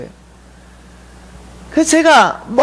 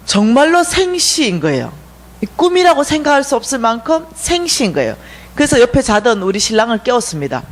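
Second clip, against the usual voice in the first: ordinary talk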